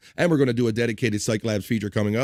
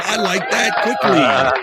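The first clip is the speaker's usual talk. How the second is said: slow, high, laughing through the words; 7 semitones higher, 6 dB louder, much slower than usual. Real, not acted. Host cracking up at a viewer's joke, laughing. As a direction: crack up while reading it out.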